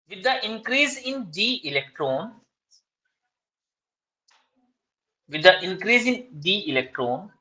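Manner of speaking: slow, deliberate dictation, each phrase spaced out. Shown as a demonstration. Teacher dictating exam answer to students, slow dictation. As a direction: read out notes word by word for students to copy.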